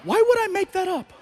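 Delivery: high, incredulous thought; indignant inner voice. Retold or imitated. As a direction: scoff at the idea. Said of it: "in my head, I'm like".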